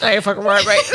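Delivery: laughing, lightly put-on Australian "right, mate"; mock Aussie bloke agreeing, half-laughing; Aussie impression. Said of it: "That's Aussie".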